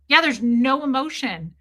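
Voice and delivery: ordinary talk, nothing marked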